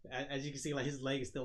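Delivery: ordinary talk, nothing marked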